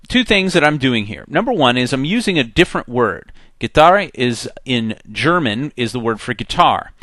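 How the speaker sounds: normal talking voice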